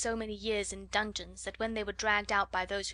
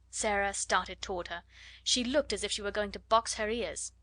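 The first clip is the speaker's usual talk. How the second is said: ordinary delivery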